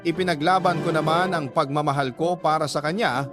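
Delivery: normal talking voice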